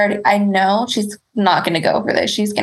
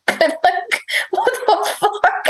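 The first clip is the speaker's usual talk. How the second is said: high, giggly exclamation; amused, high-pitched incredulity; laughing disbelief; 7 semitones higher than usual. Retold or imitated. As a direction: squeak it out through laughter. Laughing as she tells the story.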